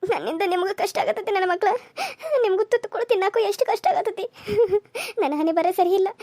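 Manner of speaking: tearful, self-blaming lament; sobbing despair; weeping. Acted. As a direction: cry helplessly while blaming your own fate. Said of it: "ನೀ ಅಳ್ಬೇಡವ್ವ".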